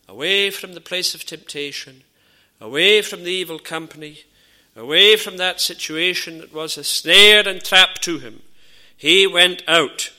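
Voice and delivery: rising fervent preaching; preaching at full force. Acted. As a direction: drive it home from the pulpit.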